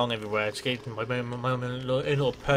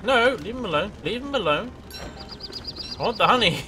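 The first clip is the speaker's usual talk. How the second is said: put-on higher, whining like a greedy child; mock whiny plea; 7 semitones higher than usual. Begging the bees to give up the honey, higher and whiny. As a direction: beg for the honey like a spoilt kid.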